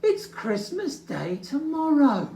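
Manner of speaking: high pleading little bear. Acted. Little Bear urging Old Bear, anxious. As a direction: urge it anxiously. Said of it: "cried Little Bear".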